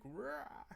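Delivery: high, short "rah" roar; mock monster roar. Streamer imitating the model when scratched, mock roar. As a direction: imitate a glitching avatar roaring. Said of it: "the model's like".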